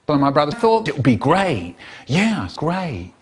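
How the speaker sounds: ordinary delivery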